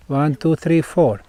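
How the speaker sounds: usual speaking voice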